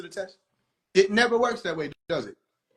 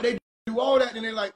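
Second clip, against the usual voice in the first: normal talking voice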